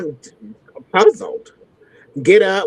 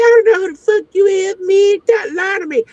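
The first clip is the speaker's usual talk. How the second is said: a high, fast mock of an angry man; imitated angry man; 12 semitones higher than usual. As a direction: mimic him losing it. Nick angry on three-way.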